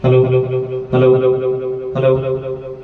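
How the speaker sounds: mic check drowned in echo; heavy echo. Shown as a demonstration. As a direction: call hello through a big echo. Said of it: "আরও ইকো দিয়েও দেখাচ্ছি বেশি করে".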